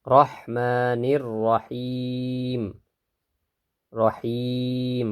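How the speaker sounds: রহিম held long on the vowel; three-alif stretch. Shown as a demonstration. Teacher showing the long stretch in রহিম. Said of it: "কেন তিন আলিফ টানবো".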